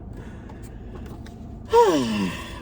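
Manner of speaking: breathy tearful sigh; real sigh; heavy sigh. Real, not acted. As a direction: let the grief out. After reading certificate.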